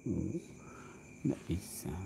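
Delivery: breathy whisper aside. Acted. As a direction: drop to a whisper.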